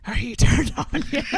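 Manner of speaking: loud mock seductive tease; teasing loud voice. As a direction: tease them loudly, no whisper. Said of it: "I can't whisper because I'm more like".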